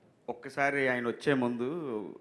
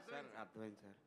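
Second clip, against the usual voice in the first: very soft, lower-pitched reply; cowed assistant; 4 semitones lower, 20 dB quieter, faster than usual. Retold or imitated. Assistant tells the angry director he understood, cowed. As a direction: mimic a junior meekly giving in.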